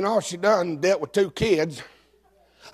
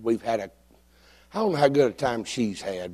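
normal talking voice

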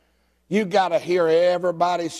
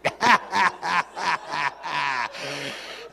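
long run of chuckling laughter; hearty laugh. Real, not acted. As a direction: laugh helplessly at your own joke. Laughs at own joke.

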